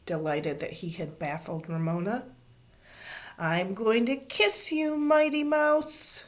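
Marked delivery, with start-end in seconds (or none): 3.4-6.2 high, drawn-out shriek rising in pitch. A narrator's reading. Ramona about to chase Davy, shrieking. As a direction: threaten gleefully in a slow, climbing squeal. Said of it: "shrieked Ramona".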